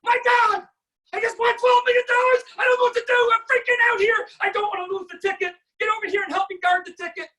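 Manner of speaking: screaming high and loud with joy, freaking out; ecstatic lottery winner. Pretend. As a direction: yell it like you just won millions. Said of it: "or is it gonna be something like".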